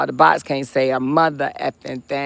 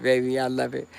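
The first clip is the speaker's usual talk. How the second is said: ordinary delivery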